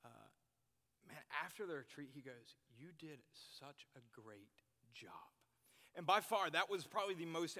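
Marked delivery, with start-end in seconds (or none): 2.8-5.1 whispered "You did such a great job"; soft confiding whisper of praise; hushed praise. Retold or imitated. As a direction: breathe it like a private compliment. Mentor's quiet praise retold.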